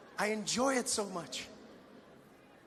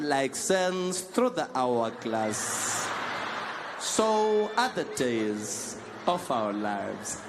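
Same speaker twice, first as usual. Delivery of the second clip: very slow, lower, grand soap-opera voice; soap opera intro; 6 semitones lower, 5 dB louder, much slower than usual. Retold or imitated. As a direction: intone it like a soap opera's opening. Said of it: "looking in the mirror going".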